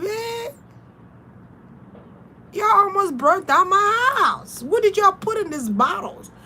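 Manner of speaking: high, tearful pleading complaint; upset. Retold or imitated. Telling whoever caused the fire they nearly burnt her house, high and tearful. Retold distress after the fire.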